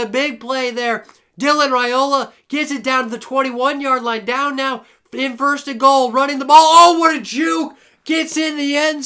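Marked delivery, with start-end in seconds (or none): 1.4-6.6 lively sports announcer call; play-by-play. Acted. Live play-by-play of game. 6.6-7.8 loud, high, drawn-out exclamation; stretched thrilled yell; excited shout. Real, not acted. Big play called live.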